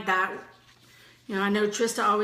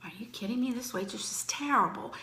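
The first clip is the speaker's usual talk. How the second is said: mocking whisper; 8 dB quieter than usual. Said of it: "she was just like".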